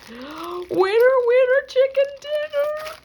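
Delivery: high shrieked victory chant; shrieking jackpot winner. Real, not acted. Shrieking at button find.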